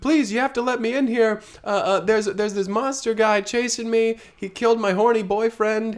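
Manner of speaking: high loud pleading; desperate plea. Acted. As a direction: beg in a high panicked voice. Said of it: "Misty says to her".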